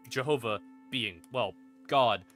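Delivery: normal talking voice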